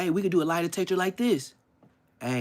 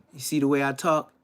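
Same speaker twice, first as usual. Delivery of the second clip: ordinary delivery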